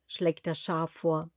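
usual speaking voice

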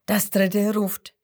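plain reading voice

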